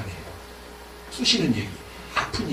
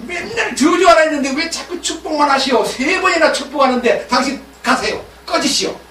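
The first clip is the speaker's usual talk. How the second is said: high, heated dismissal; angry king; 9 semitones higher, 3 dB louder than usual. Acted. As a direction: throw him out in anger. Balak angrily dismisses Balaam.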